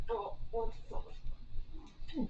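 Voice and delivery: slow, soft whisper to the cat; hushed whisper. Real, not acted. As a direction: whisper it slowly so as not to startle him.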